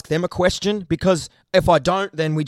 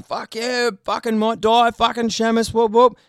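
mock cheering of hyped-up fans, higher; imitating rowdy dudes shouting praise; hammed-up bogan fan hollering whoop whoop; 4 semitones higher than usual. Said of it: "couple of random dudes come up to me".

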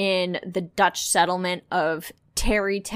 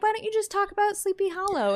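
higher, fast mock friend voice; imitating friend; 12 semitones higher, faster than usual. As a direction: toss off a quick high imitation. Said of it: "he was like".

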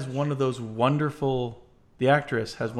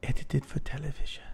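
softer, darker accented read; darker retry; 6 dB quieter than usual. Shown as a demonstration. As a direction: push the dark menace more. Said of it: "so let me try this again".

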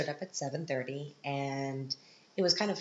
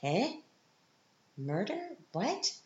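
confused surprise; 7 semitones higher, slower than usual. Retold or imitated. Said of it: "giving me this look like".

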